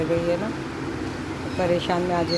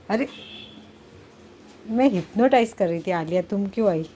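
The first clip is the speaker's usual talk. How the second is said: usual speaking voice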